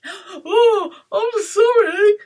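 high, loud, frightened apology; spluttering dragon. Acted. Dragon apologises to beast, frightened splutter. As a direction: wail it high, backing down. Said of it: "he spluttered".

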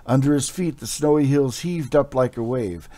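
dramatic storyteller reading an epic tale; story narration. A narrator's reading. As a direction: paint the frozen battlefield with your voice.

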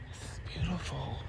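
soft whispered wonder; quiet whispered awe; awed whisper. Real, not acted. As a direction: breathe it out in a whisper.

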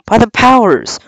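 high-pitched exclamation in character; exasperated cry. A narrator's reading. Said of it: "cried Silver".

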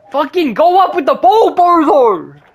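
high, loud angry yell; furious shout at a useless teammate. Real, not acted. Yelling at teammate in game.

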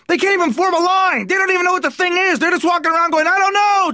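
high-pitched exasperated rant; mad, pissed. Acted. Comic ranting at dumb ants, high angry exasperation. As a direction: rail at them, voice pushed way up. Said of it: "I'm mad and I'm pissed".

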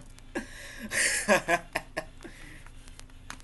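long wheezing laugh; trailing voiceless laughter; breathless laugh. Real, not acted. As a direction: laugh helplessly until out of air. Laughs while signing off.

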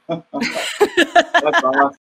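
laughing through the words, slow and high. Real, not acted. Interviewer laughing at the guest's chemo joke, laughing. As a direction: let the laugh carry the praise.

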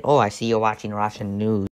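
ordinary talk

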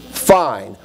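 husband imitating wife's terse reply; wife's curt fine. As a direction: mimic the wife's loaded one-word answer. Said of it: "she looks at me and goes".

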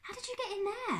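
very high-pitched amazement; astonished question. A narrator's reading. Darkus questions his trapped uncle, astonished. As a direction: ask it in high surprise.

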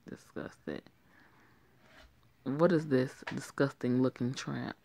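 normal talking voice

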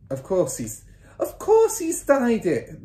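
scornful cry, rising high and drawn out; mother's cry. A narrator's reading. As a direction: cry it out, exasperated. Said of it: "the mother cried".

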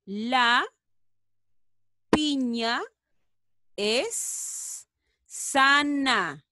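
very slow, stretched words; very slow reading. Shown as a demonstration. Teacher models the sentence very slowly. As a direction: draw out each word. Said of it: "A ver".

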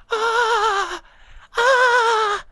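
long, very high held wail; real overjoyed howling cry. Real, not acted. Player overcome by a Shiny, high crying wail. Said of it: "estoy llorando".

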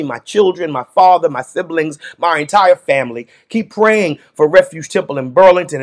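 ordinary talk

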